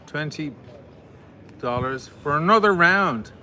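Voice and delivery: loud announcing call. Real, not acted. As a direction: call it out to the crowd. Calling out to donors, loud.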